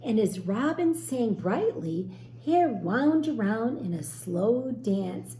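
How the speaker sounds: put-on lively storybook reading for children; teacher reading a story to young children, gently expressive; story narration. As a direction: read it like a picture book aloud to a class.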